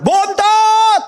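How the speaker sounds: loud, very high drawn-out shout; parent yelling. Retold or imitated. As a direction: holler for the youngest child across the house. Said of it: "kalau di rumah teriak". (teriakan orang tua memanggil anak)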